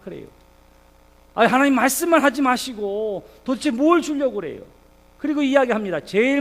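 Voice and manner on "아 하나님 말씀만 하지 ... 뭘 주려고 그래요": loud, higher-pitched protest to God; exasperated, raised-voice grumbling; acted complaint. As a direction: play Abraham demanding an answer. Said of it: "원망하는 마음이 들기도 하지요".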